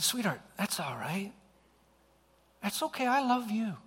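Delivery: soft, high, soothing father; hushed and loving, lifting in pitch; tender reassurance. Retold or imitated. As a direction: gently comfort a child who just lashed out. Father soothes daughter who screamed hatred.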